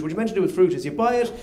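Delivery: ordinary delivery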